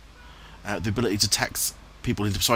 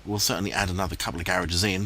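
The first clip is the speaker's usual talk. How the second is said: ordinary delivery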